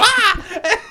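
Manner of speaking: short, high startling shriek; mock scream. Ninja scaring viewers during scary parts, mock scream. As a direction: give a sudden boo-style scream. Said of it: "come around and go".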